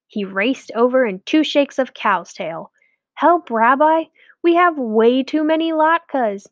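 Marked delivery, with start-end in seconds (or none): 3.2-6.5 high, urgent plea; pleading Shmuel. A narrator's reading. Shmuel pleading with the rabbi, high and urgent. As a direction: beg for help.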